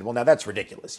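loud, haughty put-down; haughty, didactic. Retold or imitated. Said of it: "haughty and didactic".

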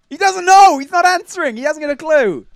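high, loud frustrated rant; exasperated outburst. Real, not acted. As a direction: cry out in exasperation. Frustrated that nobody knows the answer.

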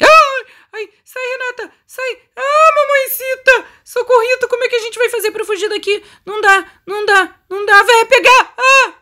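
high, frantic cries for help; panicked squealing. Real, not acted. Gamer fleeing the old woman, panicked. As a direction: squeal in panic as you flee.

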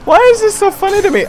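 high laughing question; high, amused disbelief; giggling disbelief. Real, not acted. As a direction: ask it while still cracking up. Laughing fit.

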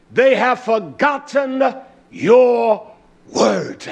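fervent preacher declaring the verse; preached. Shown as a demonstration. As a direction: lift it up and preach the line. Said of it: "Now he said".

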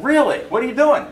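high, loud, eager questions; delighted surprise. Retold or imitated. Photographer who found his addict, high excited surprise. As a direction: jump on it, voice shooting up.

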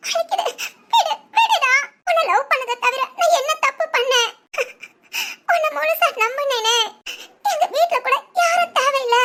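high, crying plea of betrayal; sobbing heartbreak. Acted. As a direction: weep as you beg him. Betrayed girl weeping, pleading. (அழுதபடி மனமுடைந்த கெஞ்சல்)

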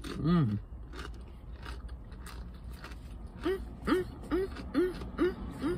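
humming while chewing food; closed-mouth chewing murmurs; mouth full, chewing. Real, not acted. Seen 1: Bites chip at -5; closed, pursed lips working throughout "mm"s.

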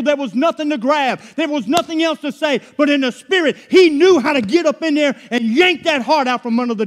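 loud, high-pitched preaching build; full-force preaching. Acted.

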